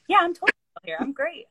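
high, loud, upbeat imitation; mock-chipper reassurance; breezy cheerful coach. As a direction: play a coach who is suddenly all sunshine. Coach's carefree club persona retold.

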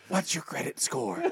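mock whisper. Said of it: "And then she says".

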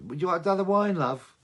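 high, loud gabbing pub chat; chatty punter voice. Retold or imitated. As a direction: mimic a chatty audience member. Mocking audience chatter on bootleg.

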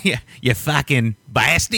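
a hammed-up Australian accent, slow and laughing through it; bad Australian accent. Mimicking the film's thug, a bad Australian accent through laughter. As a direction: drag out the curse in a fake Aussie accent. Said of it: "That was terrible".

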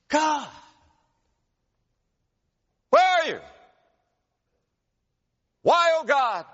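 high, loud cry of where are you; a man alone in his car shouting at God; anguished shout at God. Retold or imitated. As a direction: shout it up at heaven from a broken heart. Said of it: "I shouted at God".

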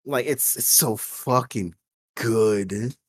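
normal talking voice

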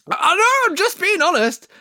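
high-pitched squeal of self-defence; squeaky mock-defensive outburst; mock protest. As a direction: protest way up high, as if under attack. Mock defence against imagined objection.

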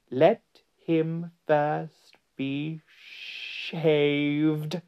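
very slow, high, drawn-out command; lingering, theatrical witch's decree; imperious witch. A narrator's reading. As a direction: stretch each word out with relish. Witch orders the lion shaved.

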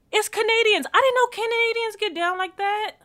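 high-pitched amazed reaction; astonished disbelief. Retold or imitated. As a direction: replay your surprise, voice way up high. Recounts disbelief that they were Canadians.

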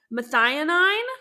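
tentative, rising "Methionine"; questioning pronunciation. Real, not acted. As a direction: try out "Methionine" as a question, ending "-nine". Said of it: "That's how you say it".

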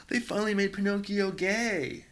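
high, loud, thrilled squeal of news; mock freak-out. Imitating Gen Z TikTokers who found clips, mock freak-out. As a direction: gush it like an overexcited fan, hammed up. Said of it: "they all freaked out".

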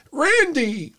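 squeaky high cry of the name; hammed-up high-pitched call; mock referee yelp. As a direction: yelp it way up high like a clueless ref. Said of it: "the referee looks over at Orton and goes".

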